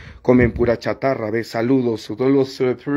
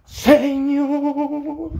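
indignant rant; 12 semitones higher, slower than usual. Real, not acted. Ranting against procession hypocrites.

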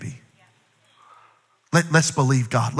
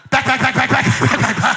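frantic; 7 semitones higher than usual. Pretend. Snatching online gift back.

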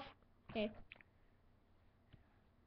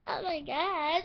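normal talking voice

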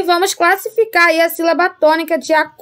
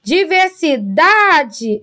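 Diversidade slowed, with DA held long; stress on DA; 2 semitones higher, much slower than usual. Shown as a demonstration. Teacher classifying words for pupils, Diversidade stressed on DA. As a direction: hit and hold DA in Diversidade. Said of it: "é a penúltima sílaba forte".

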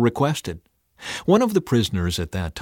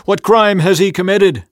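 loud, high demanding question; 10 semitones higher, 9 dB louder than usual. A narrator's reading. Pilate challenging the mob, loud demand. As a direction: fire it out as a challenge. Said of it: "Pilate demanded".

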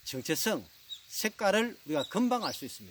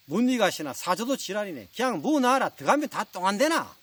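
louder, higher scolding in Gyeongsang dialect; tough Gyeongsang husband; 5 semitones higher, 5 dB louder than usual. Acted. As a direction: snap back at your bride with bouncing Gyeongsang intonation. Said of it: "터프한 경상도 신랑". (터프한 경상도 사투리 남편)

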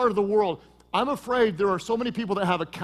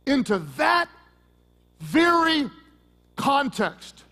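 rising emphatic build; 5 semitones higher, 3 dB louder, slower than usual. Real, not acted. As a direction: climb louder and higher word by word. Preacher building to dramatic peak.

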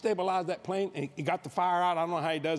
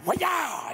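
sudden high yelp for lightning; mock lightning crack; lightning sound; 11 semitones higher than usual. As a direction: snap it out like a bolt hitting. Imitating lightning strike.

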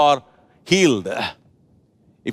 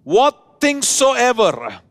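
normal talking voice

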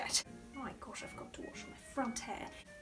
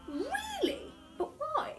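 much higher, half laughing, half crying; laughing dismay; 12 semitones higher, slower than usual. Acted. Hostess learns her phone is an iPhone eleven, high laughing wail. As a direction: squeal it out, laughing in mock despair.

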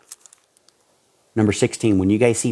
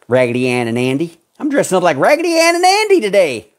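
mocking voice; 9 semitones higher, 6 dB louder than usual. Mocking a churchgoer's costume boast.

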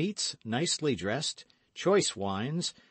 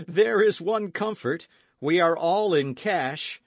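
high, loud, buoyant relief; cheerful exclamation; 8 semitones higher, 6 dB louder than usual. A narrator's reading. D'Artagnan consoling friends after the laughter, cheerful. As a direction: call out the good news brightly.

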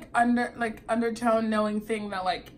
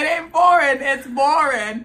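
loud, higher, amused proclamation; laughing declaration; 4 semitones higher, 12 dB louder than usual. Real, not acted. Woman repeating her dating rule to her friend, laughing. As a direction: belt out the motto through laughter.

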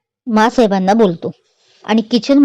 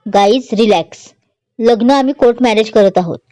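plain reading voice

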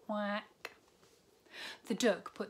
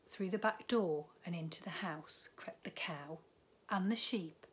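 hushed sneaky whisper; whispered storytelling; 3 dB quieter than usual. A narrator's reading. Reading to a child as the animals sneak in, whispered. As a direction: whisper as if creeping past the farmer.

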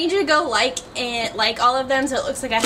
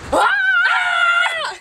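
startled shriek; 4 dB louder than usual. Real, not acted. Startled by someone behind.

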